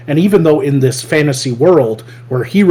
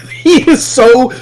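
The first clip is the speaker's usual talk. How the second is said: words through a laugh; 10 semitones higher, 4 dB louder, slower than usual. Real, not acted. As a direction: laugh it out, high and slow. Laughing while describing.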